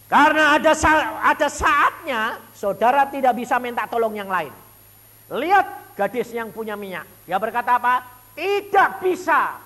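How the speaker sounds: loud, high preaching at full force. Acted. Preacher proclaiming to the congregation, loud high preaching. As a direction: proclaim it to the church.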